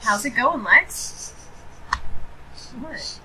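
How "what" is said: talking through a cookie; words round a bite of food; mouth full. Real, not acted. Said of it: "put an Oreo in my mouth".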